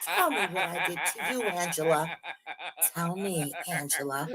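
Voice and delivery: 3 needle her in a hushed voice; 1 quiet taunt. Real, not acted. 4 player coaxing Angela to confess, quiet taunt.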